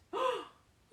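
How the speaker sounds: lively, sing-song picture-book reading; animated storybook voice with questions to the kids; reading to children. Acted. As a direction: read it like story time for little ones. Teacher reads picture book aloud.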